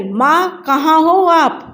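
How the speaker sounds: Komal calls out high to her mother in the house; calling out. A narrator's reading. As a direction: call across the house for Mom. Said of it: "कोमल ने आवाज़ लगाई".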